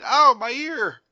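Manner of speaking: high, loud mock yelp; hammed-up pained squeal; mock cry of pain. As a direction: yelp it like a wrestler kicked in the ear. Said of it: "in which he cried".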